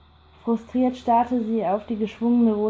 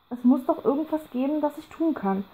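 higher, pressing plea with the calm slipping; failing to sound calm; 5 semitones higher than usual. A narrator's reading. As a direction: let the desperation break through. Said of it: "versuchte vergeblich gelassen zu klingen". (höher und drängender, die Gelassenheit bricht)

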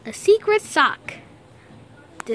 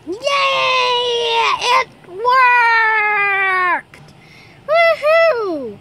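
long, drawn-out triumphant whoop; jubilant cheering; 4 dB louder, much slower than usual. Acted. Player celebrating the washed-off screen, jubilant cheering. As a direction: stretch each word into a big cheer.

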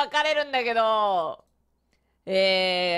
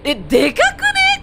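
laughing surprise; 14 semitones higher, 6 dB louder, slower than usual. Real, not acted. Surprised by huge game scene.

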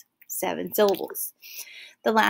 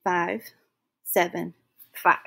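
five, seven, five, counted out slowly; counting the syllable rule with pauses; 3 semitones lower than usual. Shown as a demonstration. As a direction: tick off each number with a pause. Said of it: "like so".

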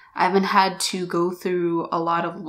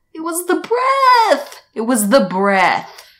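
higher, much louder, slowly stressed; emphatic realization; 6 semitones higher, 5 dB louder than usual. Retold or imitated. Vlogger insisting to viewers it was the breath, emphatic. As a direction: hammer home the discovery. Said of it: "repeats with rising emphasis".

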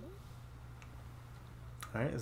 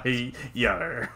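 pirate voice. Acted. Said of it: "pirate voice".

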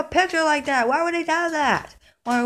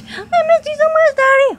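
high, loud, overjoyed crying; tearful joy; 11 semitones higher, 7 dB louder than usual. Acted. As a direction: pour out relief through tears. Son reunited with father.

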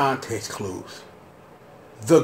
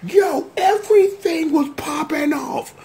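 retold hype; 11 semitones higher than usual. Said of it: "I'm like".

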